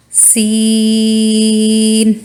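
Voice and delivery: सीन held long and slow; long seen. Shown as a demonstration. Teacher demonstrates the long सीन. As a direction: stretch सीन three seconds. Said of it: "इसमें तीन हरूफ हैं".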